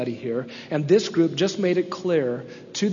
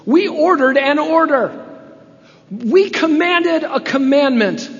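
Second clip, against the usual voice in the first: high, loud, slow, pompous decree; mock-indignant rulers laying down the law; angry accusation; 10 semitones higher, 8 dB louder, slower than usual. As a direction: bark it out like self-important commanders. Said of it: "That's the first angry accusation".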